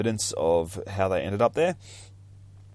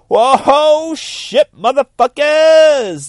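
much higher, louder, slow stretched whoop; real hyped-up scream of joy; excited yell; 15 dB louder, much slower than usual. Real, not acted. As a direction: explode with hype over the comic. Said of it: "some more Thirty Days of Night".

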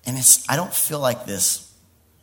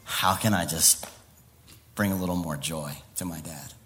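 soft, low, contented wonder; tender adoration; 9 semitones lower, 8 dB quieter than usual. Acted. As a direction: speak gently as a loving child about Dad. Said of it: "I come in from a place saying".

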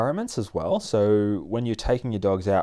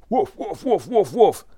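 high, rapid mock woofs; imitated dog barking; 11 semitones higher, 5 dB louder, slower than usual. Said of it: "Max starts barking".

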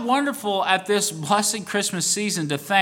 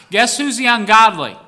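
louder, punching ungodly; emphatic question; 4 semitones higher, 7 dB louder than usual. Real, not acted. Preacher confronting the congregation, loud and emphatic. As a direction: challenge them with it.

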